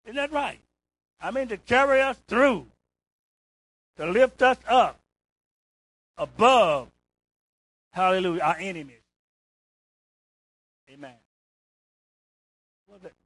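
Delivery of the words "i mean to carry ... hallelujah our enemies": preaching at full force. Real, not acted. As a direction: lift the room with each phrase. Preacher lifts congregation, loud.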